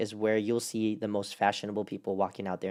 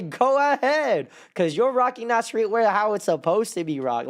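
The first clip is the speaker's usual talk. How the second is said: cheering on; 9 semitones higher, 4 dB louder than usual. Retold or imitated. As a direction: cheer them on loudly. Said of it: "I actually say".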